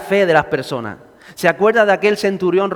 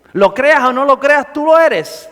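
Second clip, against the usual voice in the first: rising exhortation; 5 semitones higher, 5 dB louder than usual. Real, not acted. Preacher exhorting congregation.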